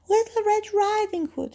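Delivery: put-on high-pitched little girl voice. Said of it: "she says".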